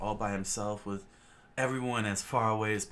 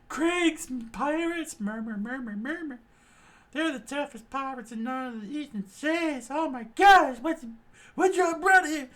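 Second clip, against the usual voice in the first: much higher, squeaky frightened voices; panicked crowd; 9 dB louder than usual. Acted. Restaurant crowd panicking over pirates, high and squeaky. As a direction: gabble in fright.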